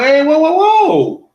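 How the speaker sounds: retold father yelling whoa to calm things; father shouting stop. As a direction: call out a father halting his son. Father stopping the son.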